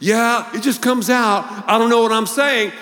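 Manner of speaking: chameleon shrugging off his swearing; high mock excuse. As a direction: play the careless excuser. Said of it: "another group".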